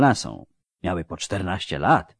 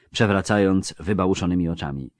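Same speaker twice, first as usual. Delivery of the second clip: usual speaking voice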